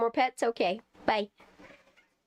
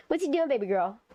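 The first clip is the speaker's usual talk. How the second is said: ordinary talk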